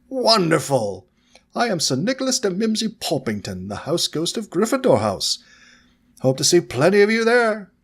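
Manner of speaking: put-on a jolly ghost gentleman greeting the children; cheerful old ghost. Ghost introduces himself.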